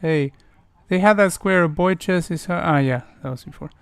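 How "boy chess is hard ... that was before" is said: normal talking voice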